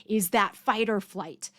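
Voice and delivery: ordinary talk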